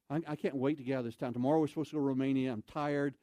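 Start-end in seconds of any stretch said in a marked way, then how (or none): none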